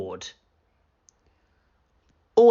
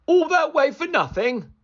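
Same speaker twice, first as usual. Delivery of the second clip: annoyed; 4 dB louder than usual. Acted. Angry villager.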